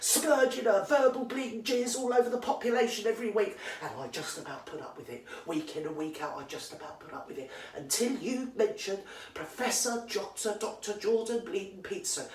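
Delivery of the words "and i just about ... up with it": sudden quiet grumble. Real, not acted. Ranting woman muttering to herself, grudgingly quiet. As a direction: drop right down, grudging.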